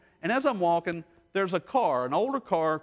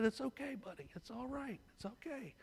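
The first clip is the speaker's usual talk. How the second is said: very soft, high-pitched comforting; gently calm a frightened dog, barely voiced; soothing; 8 semitones higher, 17 dB quieter than usual.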